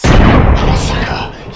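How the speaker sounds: very loud, drawn-out shout; shouted confession. Acted. Man acting out what a friend should admit, shouted confession. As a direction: belt out a mock confession at full volume.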